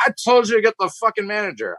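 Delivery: put-on high and fast, furious drunk demand; angry demand. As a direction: bark the demand impatiently. Drunk customer demands manager.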